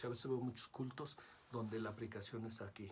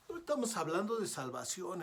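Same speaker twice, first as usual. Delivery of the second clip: higher and louder, stressing estamos; a preacher's raised correction; emphatic; 5 semitones higher, 7 dB louder than usual. Real, not acted. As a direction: hammer home "No estamos hablando de salvación". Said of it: "repeated point, stressed".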